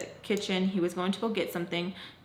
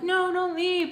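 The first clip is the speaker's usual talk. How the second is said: high mock begging; pitched-up pretend plea; imagined plea; 10 semitones higher, slower than usual. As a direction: act out a friend pleading to stay.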